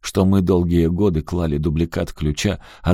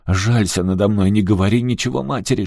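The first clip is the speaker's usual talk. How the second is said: ordinary delivery, nothing marked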